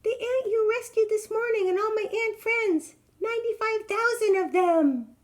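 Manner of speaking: very high, loud little ant; proud tiny squeaky voice. Acted. Ant introducing friends to the elephant, high tiny voice. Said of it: "said a wee small voice".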